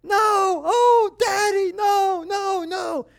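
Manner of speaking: very high, loud panicked wailing; daughter screaming. Retold or imitated. Daughter who swallowed her tooth, high crying scream. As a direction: imitate a small girl crying out in panic. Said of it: "I hear my daughter screaming".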